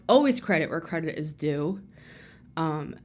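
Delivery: ordinary talk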